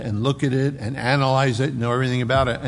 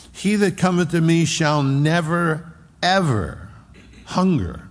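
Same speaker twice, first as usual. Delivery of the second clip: "never", "ever", "hunger" each set apart; emphatic double negative; 5 semitones higher than usual. Shown as a demonstration. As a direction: hit "never" and "ever" separately, with pauses. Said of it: "it's a double negative".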